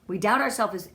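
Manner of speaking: usual speaking voice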